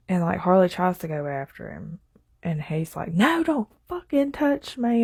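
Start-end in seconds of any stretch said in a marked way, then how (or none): 3.1-5.0 much higher, imitated angry outburst; a hammed-up angry drunk pushing her away; mock angry. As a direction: snap it back like he's furious. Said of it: "he just gets so mad".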